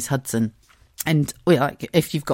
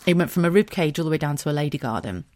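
normal talking voice

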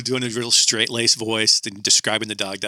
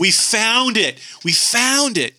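higher, slow, thrilled announcement; imitating a thrilled producer breaking good news; excited producer; 11 semitones higher, much slower than usual. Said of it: "He's all excited about it".

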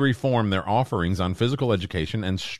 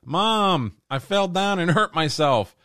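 put-on high-pitched kid calling for Mom; distant kid imitation; 10 semitones higher, slower than usual. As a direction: imitate a child whining from another room. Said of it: "off in the distance, you hear her kid go".

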